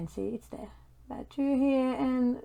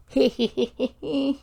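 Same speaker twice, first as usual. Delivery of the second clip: bright cartoonish giggle; cartoon laugh; 3 semitones higher, 3 dB louder than usual. Real, not acted. Laugh after placing a diamond.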